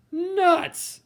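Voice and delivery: high, loud fed-up cry of "Nuts!"; exasperated outburst. Acted. Moose rejecting his failed antlers, high and loud exasperated. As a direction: blurt it out like he's had enough.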